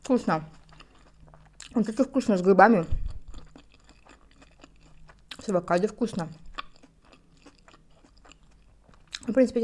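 chewing while talking; muffled by food; mouth full. Real, not acted. Seen 2: Cheeks puffed and chewing seen throughout after bite at -7.

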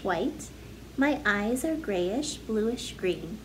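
normal talking voice

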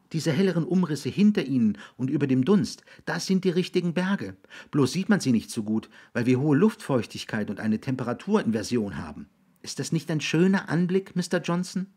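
put-on high-pitched eager young guide; enthusiastic boy. Boy showing William the mountains, keenly encouraging. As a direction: point out the view keenly.

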